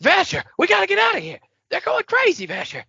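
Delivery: put-on high, fast, frantic childish voice; Charlie Day. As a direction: yelp it out like a panicky man-child. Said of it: "Childish adult makes me immediately jump to Charlie Day".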